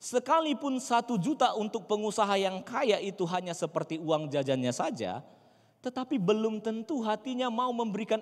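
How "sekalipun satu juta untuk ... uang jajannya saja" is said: ordinary talk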